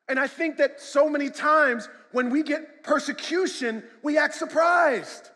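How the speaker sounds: loud, high preaching to the congregation; preacher warning the church that persecution is coming, shouting; full-force preaching. Acted.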